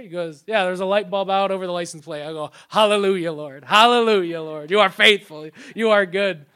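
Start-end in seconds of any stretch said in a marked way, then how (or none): 0.5-2.2 casual mechanic. Retold or imitated. Mechanic explains cheap fix. 2.7-6.4 joyful shout of praise. Retold or imitated. Pastor hearing a cheap repair bill, shouting praise.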